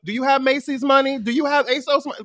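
loud, high scolding question; mock parent challenge. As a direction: grill the kid like a fed-up parent. Acting parents' stock question.

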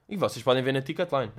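ordinary talk

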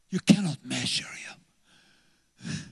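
slow breathy whisper, very slow. Real, not acted. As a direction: lean in and whisper it slowly. Whispered repeat of line 79.

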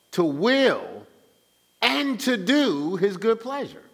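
high-pitched, slow, driven scripture teaching; emphatic preaching. Real, not acted. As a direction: preach it out, stretching the key words. Preaches the verse with force.

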